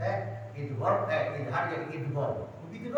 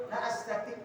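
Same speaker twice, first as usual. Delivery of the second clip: high whining mock refusal; man refusing; 12 semitones higher than usual. Man telling the Prophet he can't, high whiny voice. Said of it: "Orang itu".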